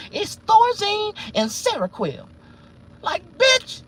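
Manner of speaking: very high mocking voice for the prison doctor. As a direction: squeak it out like a prissy doctor. Doctor's voice, then outrage.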